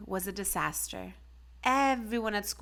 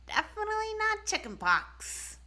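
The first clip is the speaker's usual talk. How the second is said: very high, prim specialist voice; Doctor Cricket; 13 semitones higher than usual. A narrator's reading. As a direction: chirp it like a fussy little doctor.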